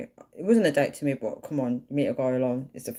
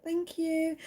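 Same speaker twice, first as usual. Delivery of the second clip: high sweet voice; 13 semitones higher, 5 dB quieter than usual. Shown as a demonstration. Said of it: "Like that".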